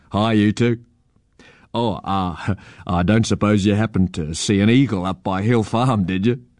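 lightly voiced uncle with a chuckle; easygoing policeman uncle, amused. A narrator's reading. Constable uncle greets children and asks about an eagle, lightly amused. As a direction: ask casually, chuckling a little.

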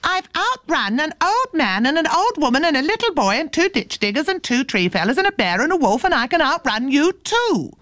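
high-pitched calling boast; very loud voice. A narrator's reading. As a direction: call the list out high, as if to someone hard of hearing. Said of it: "in a very loud voice".

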